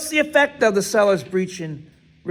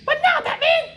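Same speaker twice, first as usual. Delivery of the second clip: much higher, cartoonish toy voice with a laugh; Robin doll voice. Teacher voicing the Robin doll for the class, high toy voice. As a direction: squeak it out like a talking toy sidekick. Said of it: "but it says".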